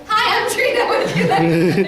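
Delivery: greeting that breaks into laughter, slowing down; cheery line dissolving into giggles; laughing through it. Retold or imitated. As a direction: try the pitch again and crack up. Repeats greeting after "Who?.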